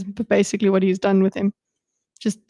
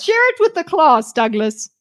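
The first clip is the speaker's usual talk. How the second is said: much higher sing-song classroom teacher voice; mock teacher; 5 semitones higher than usual. Streamer teasing Douglas in a mock teacher voice. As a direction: tease him like a strict teacher.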